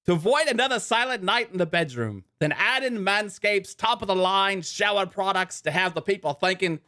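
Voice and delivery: high-pitched mock British ad read, slipping toward Australian; put-on British accent. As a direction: read the sponsor copy in a hammed-up British accent. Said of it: "losing my accent".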